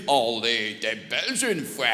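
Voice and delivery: put-on low, slow caricature of Belgian accent; mock Belgian accent. French people teasing a Belgian, clumsy mock Belgian accent. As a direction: badly imitate a Belgian accent, dragging it out. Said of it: "ce genre de Français qui se sent systématiquement obligé de nous dire".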